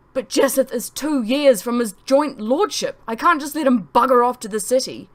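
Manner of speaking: agitated protest. Acted. Father protesting to his wife about his son, loud agitated. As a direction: push back hard and upset.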